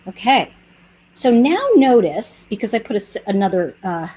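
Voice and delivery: ordinary talk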